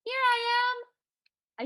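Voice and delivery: very high, ringing monotone reply; magic ring piping back; high, clear voice. A narrator's reading. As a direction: sing out on one high note. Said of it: "And the ring answered".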